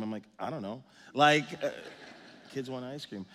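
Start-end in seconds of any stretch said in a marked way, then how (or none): 0.4-0.8 sheepish mumble. Retold or imitated. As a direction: lower and softer, guilty shrug. Sheepish answer to wife.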